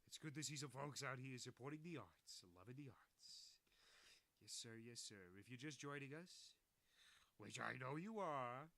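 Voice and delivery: loud, close-mic host voice; right in your ear. Acted. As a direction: boom into the mic like a showman. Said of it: "I should stop doing that right in the microphone".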